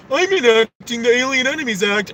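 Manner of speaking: lightly voiced sneering boss, proclaiming it; mocking the president. Mocking Trump's announcement.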